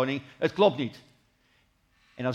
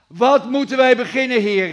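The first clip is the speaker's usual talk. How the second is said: loud, high cry of panic; desperate cry; 8 semitones higher, 6 dB louder than usual. Retold or imitated. Servant cries out to his Lord in panic, loud and high. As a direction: call out to God in alarm. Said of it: "dat hij schreeuwde".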